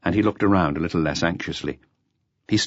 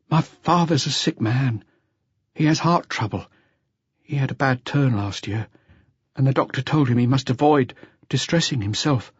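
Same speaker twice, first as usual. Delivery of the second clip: dull voice; 7 semitones higher than usual. A narrator's reading. Jean telling the inspector about his sick father, dull voice. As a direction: speak drained, strength gone. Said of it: "speaking in a dull voice".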